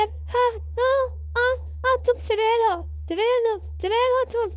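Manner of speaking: very high squawky parrot voice, frightened; a panicky parrot shrieking witch over and over; Mittu the parrot. Acted. Mittu the parrot calls her witch. (बहुत ऊँची, डरी तोते की आवाज़)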